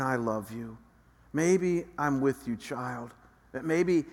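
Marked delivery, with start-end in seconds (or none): 0.0-0.8 tender whisper of love. Acted. God's tender word imagined.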